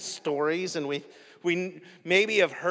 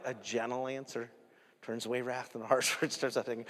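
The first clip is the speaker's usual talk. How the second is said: low, soft recited proverb; gentle calm answer; 5 semitones lower, 8 dB quieter, faster than usual. Retold or imitated. Dad quoting proverb to his screaming kids, softly.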